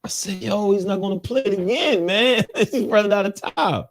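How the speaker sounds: high-pitched, incredulous retelling; worked-up complaint, voice pushed high; mock exasperation. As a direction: act out mounting frustration as time runs out. Retold panic as show time runs out.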